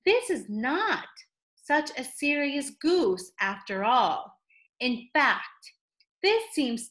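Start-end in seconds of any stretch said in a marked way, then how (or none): none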